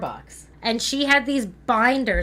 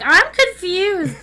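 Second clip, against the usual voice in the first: confused; 9 semitones higher, much slower than usual. Retold or imitated. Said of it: "I'm like".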